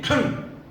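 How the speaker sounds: short imitated throat clear; signalling throat clear. President signalling his guards, curt throat clear. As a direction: clear the throat as a wordless order. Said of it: "Pak Jokowi itu ngomong gini".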